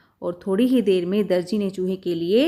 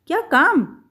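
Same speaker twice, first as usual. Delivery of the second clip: angry question; 6 semitones higher, slower than usual. Acted. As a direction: bite the words out at the mouse. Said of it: "दर्जी ने गुस्से से पूछा".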